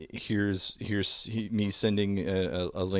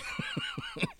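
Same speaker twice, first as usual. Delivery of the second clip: squeaky high laugh; 10 dB quieter than usual. Real, not acted. Laughing at OnlyFans turn.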